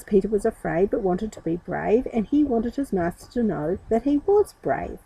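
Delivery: ordinary delivery, nothing marked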